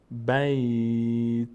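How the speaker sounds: long, level بیت with no vowel after the t; final consonant vowelless. Shown as a demonstration. Teacher models a verse-end stop, final consonant vowelless. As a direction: draw out بیت, ending on a bare t. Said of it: "باید ساکن بشه".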